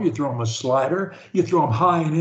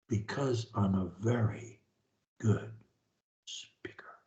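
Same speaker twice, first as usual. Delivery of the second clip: whisper; 8 semitones lower, 12 dB quieter than usual. Shown as a demonstration. Whispered boast about speaking.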